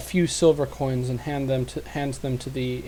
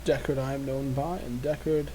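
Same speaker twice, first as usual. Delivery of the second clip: put-on old man voice. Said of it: "my best old man voice".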